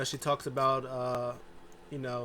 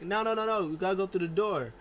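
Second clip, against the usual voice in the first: higher, louder no-no-no; Bayley hyped, insisting on the door; excited protest; 6 semitones higher, 5 dB louder, faster than usual. Retold or imitated. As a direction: stop her eagerly. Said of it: "Bayley is like".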